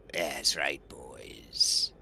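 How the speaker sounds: low, strange voice of a hooded raider; gibbering enemy voice. Acted. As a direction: growl it like a spooky old soldier. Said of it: "and he says".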